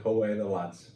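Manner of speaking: weak Geordie accent, lightly done; a hint of Geordie on Away the lads. Shown as a demonstration. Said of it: "my Geordie accent's pretty bad".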